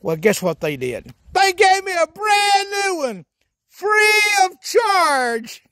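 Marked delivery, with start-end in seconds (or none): 1.3-5.6 free of charge stretched slow and high like a victory cry; excited shout. Acted. Comedy character brags to viewers, triumphant drawn-out shout.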